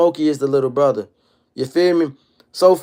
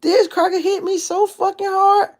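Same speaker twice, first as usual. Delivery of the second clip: much higher, stunned disbelief at the punch; shocked inner thought; 12 semitones higher than usual. Retold or imitated. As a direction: squeak out the panic of being hit hard. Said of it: "I remember saying".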